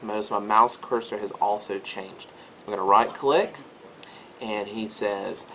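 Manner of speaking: ordinary talk, nothing marked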